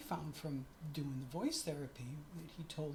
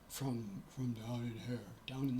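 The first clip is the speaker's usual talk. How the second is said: "from down in here" dropped much lower into the chest; male chest voice demo; 6 semitones lower than usual. Shown as a demonstration. Showing viewers how men talk from the chest, much lower. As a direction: sink the voice low to show a man's register. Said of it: "men typically talk".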